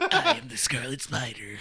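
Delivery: slow mock-heroic intro through laughter; drawn-out dramatic announcement, half laughing; overdramatic superhero. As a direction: imitate the hammy hero, amused. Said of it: "imagine him saying it like that".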